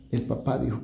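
ordinary talk, nothing marked

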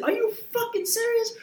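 high, disbelieving cry; can't believe what he's hearing; incredulous outburst. Retold or imitated. Retelling his reaction to the shutdown news, high and incredulous. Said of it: "I said".

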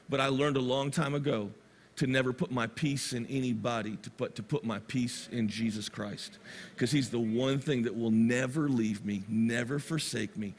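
low, softened voice of a wise mother; subdued, steady testimony in retelling; retold quiet conviction. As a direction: share her faith calmly and sincerely. Grieving mother's faith, retold.

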